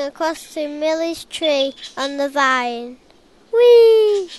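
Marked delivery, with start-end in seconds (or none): 3.5-4.3 high, loud gleeful whee; excited whee. A narrator's reading. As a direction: swing out with a joyful whee. Monkey swinging on vine.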